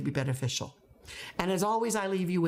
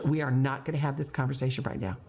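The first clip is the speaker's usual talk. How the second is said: fast, level repeat of a boundary; firm, unraised voice; 3 semitones lower, faster than usual. Shown as a demonstration. Teacher talking over student's protest, firm and level. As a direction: cut in evenly, never raising the voice. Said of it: "my voice is not going up".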